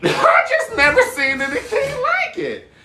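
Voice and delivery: high, loud laughter through the words; cracking up, almost crying with laughter; real belly laugh mid-sentence; laughing hard. Real, not acted. Cracking up at the dance.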